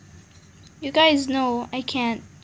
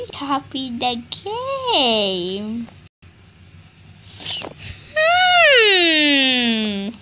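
mocking; 4 dB louder, much slower than usual. Slow mocking, then fake cry.

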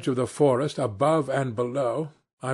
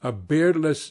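ordinary delivery

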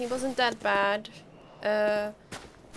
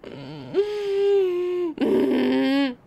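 long, loud high-pitched laughing whine; high laughing squeal; 8 semitones higher, 9 dB louder than usual. Real, not acted. Gamer reacting to the game, loud high squeal. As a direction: let out a drawn-out high squeal.

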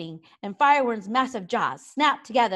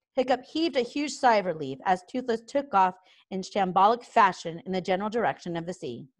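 ordinary delivery

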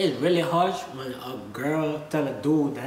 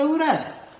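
"Who that?" pitched much higher, a quick surprised ask; quoted question; 9 semitones higher than usual. Retold or imitated. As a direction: toss out "Who that?" high and curious. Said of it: "and I be like".

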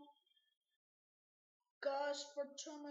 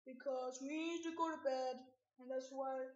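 ordinary talk

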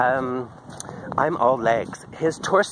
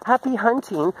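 cheeky sign-off; 8 semitones higher than usual. Retold or imitated. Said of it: "that's what I say".

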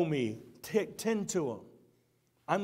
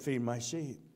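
gentle Jesus voice; 8 semitones lower, 8 dB quieter than usual. Acted. Jesus tenderly charging Peter to care for his lambs. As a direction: lay the charge on him gently.

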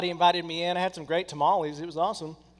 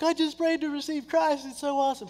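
much higher, bursting with joy; thrilled teen; 10 semitones higher than usual. Retold or imitated. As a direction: sound like a kid who can't contain it. Teen thrilled after conversion.

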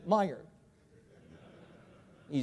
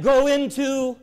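calling out; 5 semitones higher, 6 dB louder, slower than usual. Shown as a demonstration. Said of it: "call and response with congregation".

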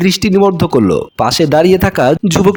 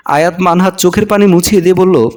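plain reading voice

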